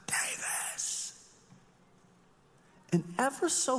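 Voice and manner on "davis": breathy whispered name; faint call. Retold or imitated. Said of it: "I call his name".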